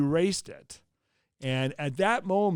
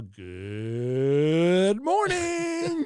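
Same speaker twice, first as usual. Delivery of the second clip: drawn-out greeting; much slower than usual. Retold or imitated. Rookie weatherman greeting viewers on his first show, very slow and drawn out. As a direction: stretch good morning very slowly.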